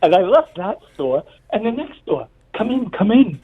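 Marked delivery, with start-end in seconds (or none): 2.6-3.4 high, eager voice of a fussing shop lady; a light imitation of a doting Italian shopkeeper; caring shopkeeper. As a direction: play the kindly store owner pulling him inside. Italian shopkeeper inviting him in.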